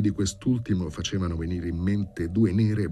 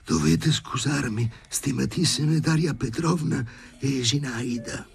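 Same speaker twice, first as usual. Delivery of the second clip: high, strained choked apology; halting high-pitched croak; hoarse, short of breath; 8 semitones higher than usual. A narrator's reading. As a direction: apologise while being throttled. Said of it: "disse l'arrochito Sharikov col fiato mozzo".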